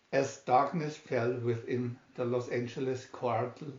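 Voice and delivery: ordinary delivery, nothing marked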